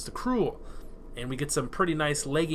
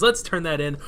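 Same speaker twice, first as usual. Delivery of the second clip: normal talking voice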